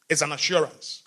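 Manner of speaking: ordinary talk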